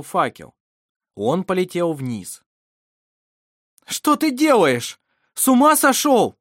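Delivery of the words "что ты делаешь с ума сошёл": high, loud outburst of disbelief; shocked exclamation. A narrator's reading. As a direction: exclaim it loudly, pitched way up. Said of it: "воскликнул Вассар". (потрясённый громкий возглас)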